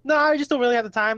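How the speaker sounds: voiced sketch character. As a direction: act out turning down a telemarketer. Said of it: "He's like".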